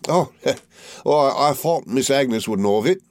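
fawning Uriah. A narrator's reading. To Copperfield, as fawning Uriah with oily humility. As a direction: grovel and simper through every word.

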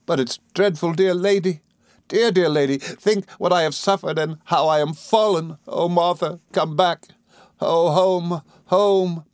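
high, anguished lament; Emily, despairing. A narrator's reading. Emily begging the lady, despairing.

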